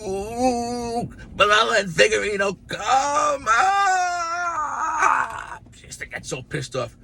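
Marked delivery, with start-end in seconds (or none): 0.0-5.6 high shrieking angry nun; mock nun scream. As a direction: shriek it like a furious old nun. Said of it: "she would scream".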